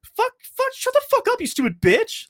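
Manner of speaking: high, loud mock screech of insults; hostile snapping. Friend imitating Gemma screaming insults at the host, high and loud. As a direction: screech abuse at her, way up and loud. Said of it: "super hostile towards her".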